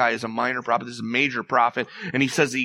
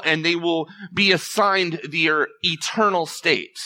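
fervent preaching; 5 semitones higher, 4 dB louder than usual. Acted. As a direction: preach it with mounting fire. Preacher building to full force.

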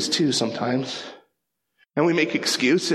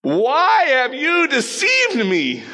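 high, loud and slow, an alarmed outcry; cry out; 11 semitones higher, 4 dB louder, slower than usual. A narrator's reading. The witch crying out at Saul in high, slow alarm. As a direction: cry it out in alarm as the trick dawns. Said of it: "she cried out at the top of her voice".

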